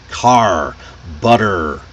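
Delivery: car and butter ending in a long, strong R; post-vocalic R. Shown as a demonstration. Teacher giving examples of post-vocalic R, strong final R. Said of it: "the post-vocalic R".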